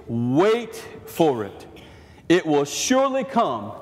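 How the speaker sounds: scripture read slow and low to the end; slow emphatic reading. Acted. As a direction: let each word land slowly.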